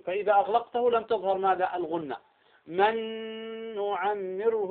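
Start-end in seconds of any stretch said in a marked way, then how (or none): 2.7-4.7 "من نعمره" with a two-count nasal hum on ن; Ghunna-Demo. Shown as a demonstration. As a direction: hold the doubled ن of "من نعمره" in the nose. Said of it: "وهي بمقدار حركتين".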